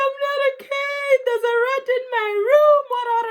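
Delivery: very high, half-crying and loud on the rat line; hammed-up tearful wail, as if about to cry; whiny panic. Retelling panic to the neighbour.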